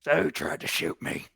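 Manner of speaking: husky, whispery creature question; gnarled monster voice. Acted. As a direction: question them as the looming monster. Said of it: "Slowly, like, stands up, stretches".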